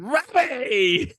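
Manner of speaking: high, loud drawn-out yell of a name; mock calling out. Interrupting the classroom story to call out Robbie, mock yell. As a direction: holler the name in mock reproach.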